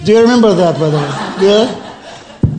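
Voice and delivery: laughing through the words, pitch jumping up; can't stop laughing while asking. Real, not acted. Laughing as he reminds Hedi of her joke, laughing. Recalling a funny moment with Hedi.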